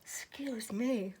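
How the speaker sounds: slow words through a yawn. Real, not acted. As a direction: yawn while speaking. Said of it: "I don't know why I'm yawning".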